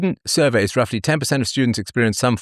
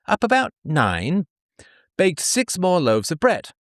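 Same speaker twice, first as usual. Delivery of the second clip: much higher, prim and dutiful voice; pioneer woman reading her diary; 7 semitones higher than usual. Acted. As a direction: read like a hardworking frontier wife. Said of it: "diary entry of a pioneer woman".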